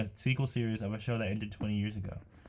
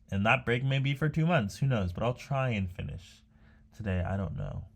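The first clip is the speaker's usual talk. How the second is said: normal talking voice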